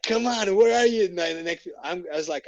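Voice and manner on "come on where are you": raised, eager call. Retold or imitated. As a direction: call out for someone, louder. Calling out for targets.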